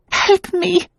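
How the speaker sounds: high, frightened plea; a woman crying for help after a nightmare; terrified cry. A narrator's reading. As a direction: beg out of pure fear.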